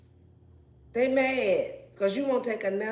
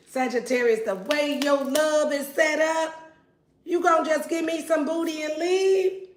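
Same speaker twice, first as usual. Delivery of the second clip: higher, pleading ex lover; voicing the jilted ex; 6 semitones higher than usual. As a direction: plead like the abandoned ex. Voicing the yearning ex.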